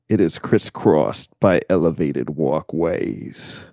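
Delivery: low, slow ASMR narration voice; failed ASMR voice. Shown as a demonstration. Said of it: "that was an absolute disaster".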